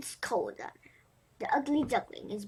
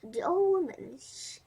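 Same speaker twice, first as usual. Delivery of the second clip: ordinary delivery, nothing marked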